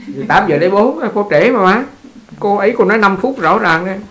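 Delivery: loud, high bantering retort; playful protest. Real, not acted. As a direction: protest loudly, in good humour. Bantering protest over the time.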